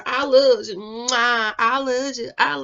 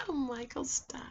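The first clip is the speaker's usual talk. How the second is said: put-on cute girly giggle; 3 semitones higher, 12 dB quieter than usual.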